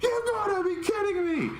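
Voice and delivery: very high squeal of disbelief; imitated freak-out. Jesse freaks out at the news, hammed-up high squeal. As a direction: friend losing it, pitch through the roof. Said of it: "Jesse freaks out".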